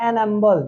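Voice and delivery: एन अम्बल, N linked to the vowel; old silent-H pronunciation. Shown as a demonstration. Teacher showing old article usage: एन अम्बल without H. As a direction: drop humble's H in एन अम्बल. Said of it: "इसके साथ लगता था".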